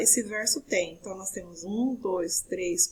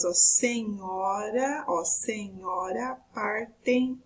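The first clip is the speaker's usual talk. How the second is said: slow, separated syllables; much slower than usual. Shown as a demonstration. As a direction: break the verse into its syllables, drawing each out. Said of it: "por exemplo, as sílabas gramaticais".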